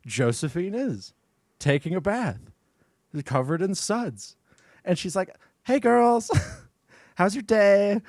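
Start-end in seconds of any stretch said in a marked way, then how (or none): 5.6-8.0 high singsong hello, breaking into a laugh; playful girly greeting. Acted. Josephine greeting the girls from the bath, mock-casual and high. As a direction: put on an innocent, higher-pitched hello.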